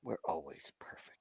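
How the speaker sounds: whispered aside; whispering. Real, not acted. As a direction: whisper it dryly. Whispered quip.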